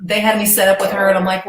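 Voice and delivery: ordinary talk, nothing marked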